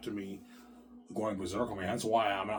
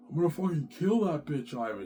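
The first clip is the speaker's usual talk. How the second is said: high-pitched mock threat; crazed jealous wife; 5 semitones higher than usual. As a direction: imitate a wife threatening a rival. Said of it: "she's crazy".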